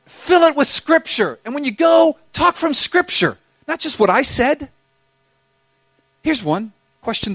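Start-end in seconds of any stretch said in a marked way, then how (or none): 0.1-4.7 urgent exhortation. Real, not acted. Pastor urging the congregation.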